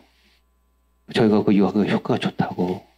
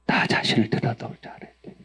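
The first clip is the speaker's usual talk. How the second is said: 1 whispered emphasis; 6 dB quieter, faster than usual. Acted. Whispered key point.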